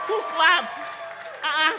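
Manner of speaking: calling out high and loud to the crowd; rally the crowd with a shout. Real, not acted.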